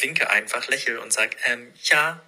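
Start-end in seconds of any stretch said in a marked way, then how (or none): 1.5-2.2 rising, slightly louder forced-polite thanks; strained cheerful thank-you with a forced grin; awkward polite thanks. Retold or imitated. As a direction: smile and wave it off like the penguin. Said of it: "wie der Pinguin bei Madagaskar".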